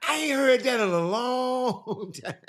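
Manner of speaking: laughing. Real, not acted. Laughing at the claim.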